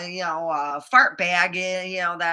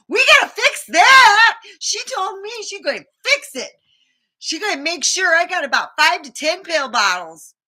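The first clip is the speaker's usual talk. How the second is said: mocking the assistant; 9 semitones higher, 9 dB louder than usual. Retelling the physician assistant.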